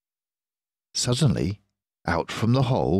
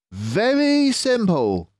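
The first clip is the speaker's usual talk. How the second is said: slow, raised sly fox; a drawn-out, knowing Mister Fox; 5 dB louder, slower than usual. Acted. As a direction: clever fox leader, pleased with himself.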